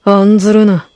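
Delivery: ordinary talk, nothing marked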